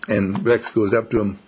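ordinary talk

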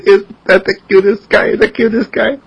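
put-on high, cooing baby-talk voice; gushing high baby coo; cooing over baby. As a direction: coo it like a doting relative.

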